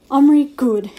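solemn pronouncement. A narrator's reading. Little Bear pronounces judgement on Omri, solemn. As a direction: declare it like a chief. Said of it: "he pronounced at last".

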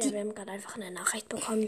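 quick hushed whisper. Real, not acted. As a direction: whisper it fast, aside. Heimlich über eingegangene Nachricht. (geflüstert)

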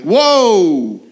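a loud startled whoa; mock-shocked branch yelling at the gardener; startled cry. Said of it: "We're like".

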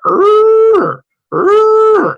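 high, loud strained err; a sudden high wailing alarm groan; internal alarm groan. Retold or imitated. Inner alarm going off in his head, high loud err. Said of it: "internal sound that goes".

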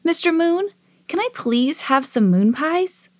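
put-on high, childlike request; polite pleading boy. A narrator's reading. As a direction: ask sweetly and hopefully. Boy asks the moon politely.

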